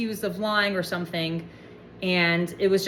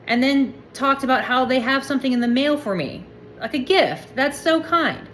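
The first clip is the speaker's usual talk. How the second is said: mock delight; 5 semitones higher than usual.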